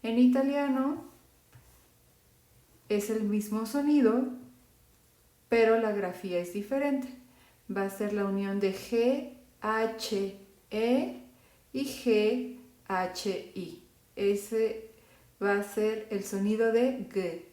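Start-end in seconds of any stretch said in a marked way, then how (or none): none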